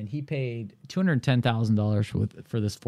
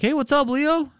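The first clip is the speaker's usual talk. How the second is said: cheery greeting. Retold or imitated. Answering client's phone call.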